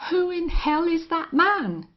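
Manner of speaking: loud, high furious yell. Acted. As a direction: yell it at the sky. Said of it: "yelled the rustler".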